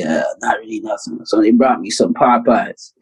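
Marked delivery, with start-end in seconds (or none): 0.1-1.2 low, soft, sore-throated reply; lightly imitated post-surgery weakness. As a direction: answer weakly as if your throat hurts. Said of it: "my throat's hurting and everything".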